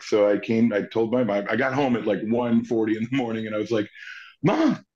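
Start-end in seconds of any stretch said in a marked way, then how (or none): 4.4-4.8 put-on high-pitched kid calling for mom; retold breaking of big news, voice way up; excited announcement. Son telling mom at one forty a.m., excited.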